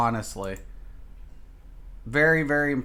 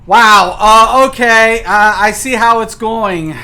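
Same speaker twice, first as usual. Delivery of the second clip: loud, raised-pitch mock acceptance; loud, higher, exasperated reaction; indignant outburst; 5 semitones higher, 12 dB louder, slower than usual. Real, not acted. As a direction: call it out loudly, affronted by the game.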